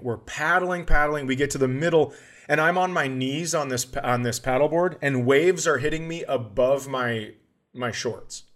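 ordinary talk, nothing marked

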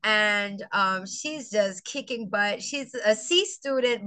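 ordinary talk, nothing marked

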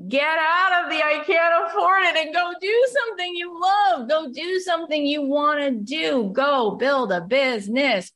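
high and loud, pushing them to act; fired-up coaching call; emphatic shout. Retold or imitated. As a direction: yell it like a pep talk. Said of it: "I'm like".

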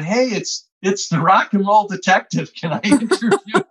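high, laughing cheeky pitch as he retells the call; grinning through his own joke. Retold or imitated. Telling the retired agent he's the rock and roll detective, laughing.

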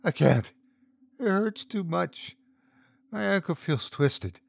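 high, pleading little whine; pitiful whine. Acted. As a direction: whimper the complaint. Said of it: "she whined pitifully".

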